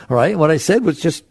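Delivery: ordinary delivery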